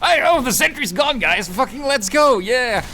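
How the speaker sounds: high, loud hype; excited cheer. Real, not acted. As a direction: cheer the team on. Sentry destroyed, cheering.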